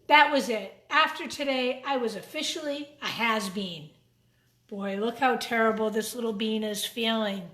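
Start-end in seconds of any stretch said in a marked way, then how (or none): none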